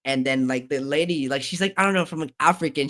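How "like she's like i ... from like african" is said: ordinary delivery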